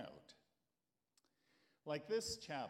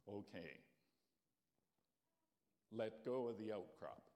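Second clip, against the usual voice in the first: lower, softer, unhurried voice of God; God reassuring Jack on the cliff, calm and low; 5 semitones lower, 4 dB quieter than usual. Acted. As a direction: speak from above, serene. God speaks calmly to Jack.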